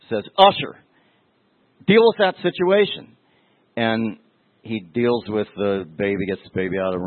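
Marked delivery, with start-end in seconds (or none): 0.4-3.1 sharp, much higher and louder call-out; stern pastor's command. Retold or imitated. Pastor ordering the usher to handle the crying baby, stern and loud. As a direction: bark the order across the church. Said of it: "and so the pastor says".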